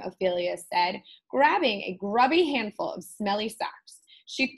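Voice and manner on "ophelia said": plain reading voice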